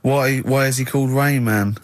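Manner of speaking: ordinary talk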